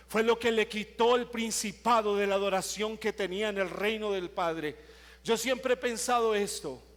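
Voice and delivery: full-force pulpit preaching; fiery preaching. Acted. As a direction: preach it out to the congregation.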